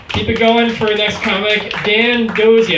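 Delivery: loud hype intro, pitch climbing; host announcement. Real, not acted. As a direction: bring up the next comic like an MC. Host introducing next comic.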